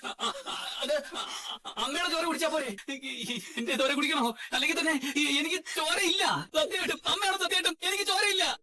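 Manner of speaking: scared stammering, halting starts; comic frightened begging; trembling with fear. Acted. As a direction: plead nervously, tripping over the first sounds. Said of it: "പേടിച്ച് വിറച്ചല്ലേ".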